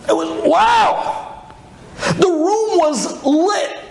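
high, slow breathless wonder; high drawn-out awe at the light; awed wow. Retold or imitated. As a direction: let the wow hang in amazement.

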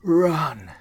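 full-throated yell, high and loud. Acted. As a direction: scream it with everything you have. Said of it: "yelling to Troy as loud as she could".

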